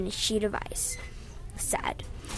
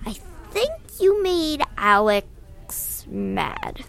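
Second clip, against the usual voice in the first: slow, higher, different voice; slow character voice; 5 semitones higher, much slower than usual. A narrator's reading. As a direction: drawl it out slowly. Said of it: "I said".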